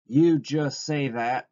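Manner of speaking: slow, sharp scolding; sharp aunt's reproach, words stretched; sharp retort. A narrator's reading. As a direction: cut him off with a pointed rebuke. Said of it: "she said sharply".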